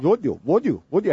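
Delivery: mock Indian accent. Host imitating his friend from India, mock Indian accent. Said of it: "there's always a".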